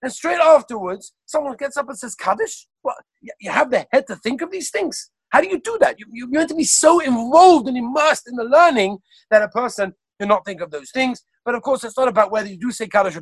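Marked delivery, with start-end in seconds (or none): 0.0-11.2 raised, incredulous protest at the yeshiva; retold indignation. Lecturer retelling Rav Zalman's dismay, mock indignant. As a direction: voice the sage's shocked outrage, higher pitched. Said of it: "He was so upset".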